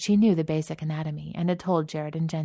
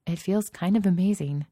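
usual speaking voice

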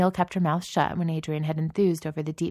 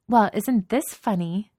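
put-on slow, higher mean-girl taunt; mocking; 5 semitones higher, 6 dB louder than usual. A narrator's reading. Katie confronts the lunch table with slow, mocking sweetness. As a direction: draw it out with smug mockery, louder.

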